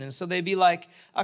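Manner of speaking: usual speaking voice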